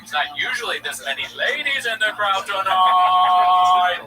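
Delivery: hammed-up comedian addressing the ladies; mock stand-up crowd work. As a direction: play a comic stalling with the crowd.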